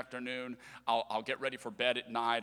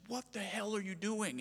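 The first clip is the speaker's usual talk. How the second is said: retold in a higher, softer voice; exasperated mom; 7 semitones higher, 6 dB quieter than usual. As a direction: lightly mimic a mother at her wits' end. Mom snaps at misbehaving child.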